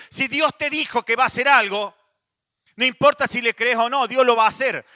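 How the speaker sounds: fervent shouting preacher; preaching loud. Real, not acted. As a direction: proclaim it with full force. Preacher proclaims emphatically.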